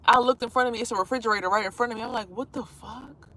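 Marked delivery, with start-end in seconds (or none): 2.3-3.3 a slow, hushed what-the-fuck; hushed disbelief. Retold or imitated. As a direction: breathe it out, baffled and slow. Confused at a fridge ahead.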